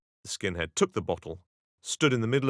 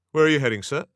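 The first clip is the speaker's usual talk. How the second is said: loud, stern police challenge; sharp authoritarian tone; 6 semitones higher, 10 dB louder, faster than usual. Retold or imitated. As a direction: bark it like a stern officer. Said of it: "with a sharp, authoritarian tone".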